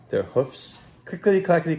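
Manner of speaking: plain reading voice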